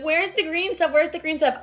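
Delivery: imitated chorus of nagging commenters; mimicking pestering followers. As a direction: parrot the viewers' question up high. Mimics viewers' repeated question.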